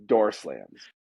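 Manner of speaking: ordinary talk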